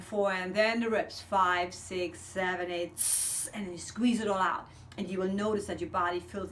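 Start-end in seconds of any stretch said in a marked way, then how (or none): 3.0-3.5 short unvoiced hiss; tss hiss. Shown as a demonstration. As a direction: push out a sharp tss. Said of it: "on a T S".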